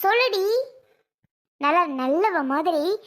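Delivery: ordinary delivery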